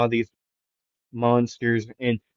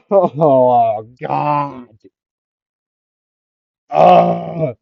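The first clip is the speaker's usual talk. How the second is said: hurting groans; 4 semitones higher, 5 dB louder than usual. Retold or imitated. Midoriya in pain, groaning.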